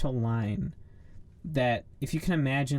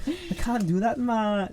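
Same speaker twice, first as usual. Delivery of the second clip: mocking high Jamaican accent; 9 semitones higher, 4 dB louder, slower than usual. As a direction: tease with an exaggerated Jamaican accent. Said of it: "that's what you sounded like".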